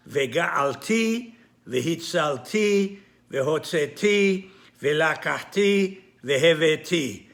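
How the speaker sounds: each Hebrew verb stressed on its final "ti"; stress on last syllable. Shown as a demonstration. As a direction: land firmly on the closing "ti" of Vega'alti, Vehitzalti, Vehotzeti, Velakachti, Veheveti. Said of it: "they are pronounced".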